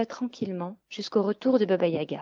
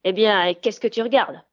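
grumbling; 6 dB louder than usual. A narrator's reading. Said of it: "la sorcière grommela".

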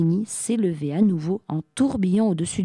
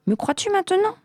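very high, little squeaky genie; tiny voice. A narrator's reading. Genie asks from inside the bottle, tiny voice. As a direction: shrink the voice right up high. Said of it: "résonna la petite voix à l'intérieur".

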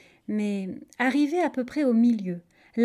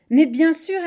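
loud, high, eager agreement; delighted burst of assent; very happy; 6 semitones higher, 10 dB louder than usual. A narrator's reading. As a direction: agree with joyful enthusiasm. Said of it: "a dit le crocodile tout heureux".

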